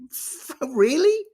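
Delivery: stunned mocking disbelief; incredulous. Real, not acted. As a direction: drag it out in disbelief. Incredulous at headline.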